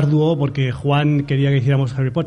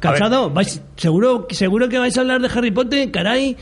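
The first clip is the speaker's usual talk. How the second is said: higher pitched sneering troll voice; 5 semitones higher than usual. Retold or imitated. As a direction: read the tweet like a scornful internet troll. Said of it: "los tweets de trolls los leo así".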